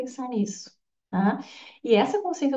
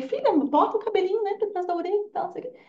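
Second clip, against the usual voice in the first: coaxing daughter; 9 semitones higher, faster than usual. Retold or imitated. Mother coaxing her daughter.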